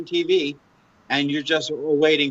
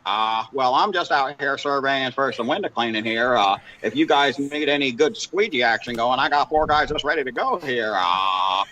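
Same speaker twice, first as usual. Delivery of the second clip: Joe impression. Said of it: "You're so good at the impressions".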